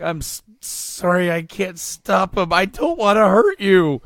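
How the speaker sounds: high, slow, stammering desperation building loud; distressed plea. Acted. Harvey apologizing to Bruce as control slips, desperate. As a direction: plead helplessly, voice climbing to a cry.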